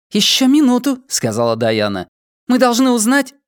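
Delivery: high, cool woman's voice; Dayana's voice. Acted. Dayana questioning the drugged prisoner, high and cold. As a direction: play the cold interrogator lady.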